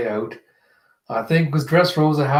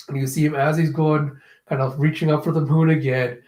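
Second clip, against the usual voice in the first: normal talking voice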